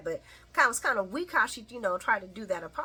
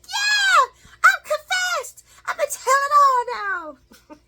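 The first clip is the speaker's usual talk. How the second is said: much higher, louder, jubilant cry; giddy shout; 10 dB louder than usual. Retold or imitated. Nina leaving confession, shouting for joy. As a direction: shout it out like you're set free. Nina feeling alive and free.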